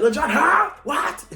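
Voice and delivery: very high and loud; high, loud hype-man what. Retold or imitated. [name] deafness joke.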